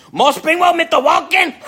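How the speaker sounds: the Chinese restaurant waitress greeting Walken, high and loud; imitating the waitress. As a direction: belt it out high like the excited lunch lady. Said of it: "She'd go".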